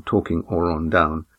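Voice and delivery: usual speaking voice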